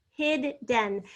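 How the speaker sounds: "hidden" as hid, den, with a short gap; "hidden" in two separate beats; split into syllables. Shown as a demonstration. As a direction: sound out both Ds in "hidden". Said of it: "We're doubling the D".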